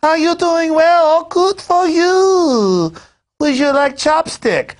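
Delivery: high, loud, hammed-up fake Japanese accent; mock Japanese accent. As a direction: condescendingly imitate talking down in fake Japanese-accented English. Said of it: "Japanese person or something like".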